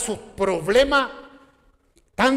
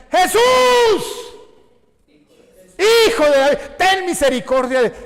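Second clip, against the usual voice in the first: high, loud desperate cry across a crowd; shouting for mercy; 10 semitones higher, 9 dB louder than usual. Retold or imitated. Blind man shouts at Jesus, loud desperate cry. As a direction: yell it out to be heard over the noise. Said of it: "comienza a gritar".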